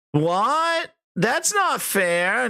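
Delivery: high, slow, drawn-out whine; mocking a whiny complainer, dragged out slow; whining mock. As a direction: whine like a sore loser. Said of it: "they're all whining".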